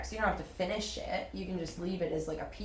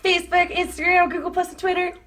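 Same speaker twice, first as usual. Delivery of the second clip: silly voice; 12 semitones higher, 11 dB louder than usual. Pretend. Mock promo shout-out.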